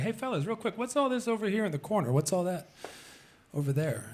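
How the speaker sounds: tactful Julie. Retold or imitated. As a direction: tiptoe around it politely. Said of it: "She's like".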